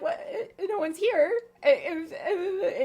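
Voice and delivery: high, loud mock sobbing; imitated crying. Little robot finding the manor empty, hammed-up crying. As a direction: whimper it like a pathetic whiny baby. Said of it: "crying about it".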